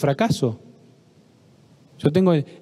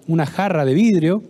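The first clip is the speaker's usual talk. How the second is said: ordinary talk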